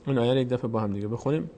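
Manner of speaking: normal talking voice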